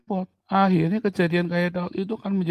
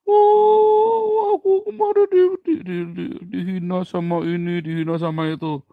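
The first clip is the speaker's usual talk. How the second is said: put-on much higher, loud whimpering kid voice; whiny crying child; 4 semitones higher, 7 dB louder than usual. Bullied child comes home crying to dad, high and whining. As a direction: wail like a bullied little kid.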